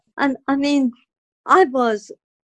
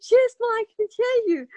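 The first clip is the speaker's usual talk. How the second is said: much higher, tremulous with emotion; overjoyed answer; 13 semitones higher than usual. Retold or imitated. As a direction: answer in wonder and joy. Answering mother after hearing.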